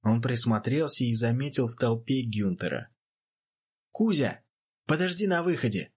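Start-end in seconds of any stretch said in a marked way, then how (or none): 3.9-5.9 high raised call over noise; shouting over crowd. Acted. Boxer calling to his friend through the crowd, shouting. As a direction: lift the voice above a cheering crowd. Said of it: "Пытаясь перекричать толпу, закричал он".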